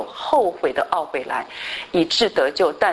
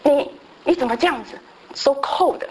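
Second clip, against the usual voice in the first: retold angry outburst; 7 semitones higher than usual. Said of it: "就那天早上就发脾气".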